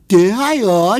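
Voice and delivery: loud, high comic dumb-guy voice. Retold or imitated. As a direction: belt it out like the dopey Finnegan. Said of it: "I said".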